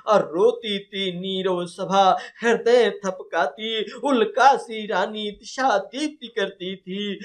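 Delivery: slow, measured verse, pitched high; poem recitation. Acted. Teacher reciting verse about the grieving night assembly, slow declamation. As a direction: recite with long, stretched vowels and swelling phrases.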